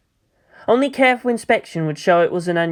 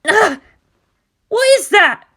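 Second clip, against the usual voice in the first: put-on much higher, frantic young pilot in pain; panicked girl's voice; 5 dB louder than usual. Mayumi crying out to Asuka's attack in high panic. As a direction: squeal it out as the field is torn.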